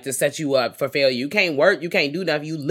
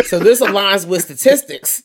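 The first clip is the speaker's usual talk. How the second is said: louder, laughing through the words; laughing quote; 3 semitones higher, 6 dB louder than usual. Real, not acted. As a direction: laugh out the line as you say it. Laughing while quoting herself.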